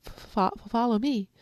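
stutter, terrified; stammering timid. Acted. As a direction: rabbit leading lion, stammering. Rabbit stammers.

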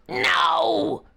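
loud high roar; tiger roar. Acted. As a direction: roar in frustration. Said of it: "roared the tiger".

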